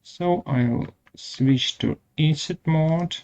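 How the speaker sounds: normal talking voice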